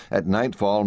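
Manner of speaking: usual speaking voice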